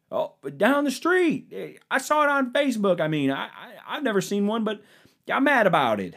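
high, indignant mocking voice; hammed-up outrage in a high voice; mock outraged conservative. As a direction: play a riled-up Facebook uncle. Same mock character answers.

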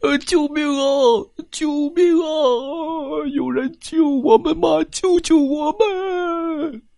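high, wailing cries for help; hammed-up animals sobbing for rescue. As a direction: animals wailing to be saved from the cliff. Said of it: "嚎啕大哭起来".

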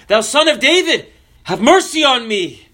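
high-pitched imitated plea; crying out. Blind man calls to passing Jesus, crying out. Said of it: "he began to cry out".

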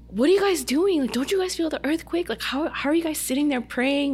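asking praying friends about the earthquake, alarmed. Retold or imitated. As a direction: plead with them to notice. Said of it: "And I'm like".

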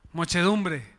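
ordinary talk, nothing marked

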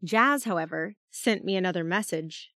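plain reading voice

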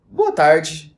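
a hint of a formal anchor opening the show; news anchor greeting. Imitating the anchor greeting viewers at the start.

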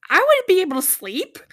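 high, loud disbelief; incredulous exclamation. Real, not acted. Exclaims over sleeping in storm.

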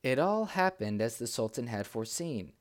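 ordinary delivery, nothing marked

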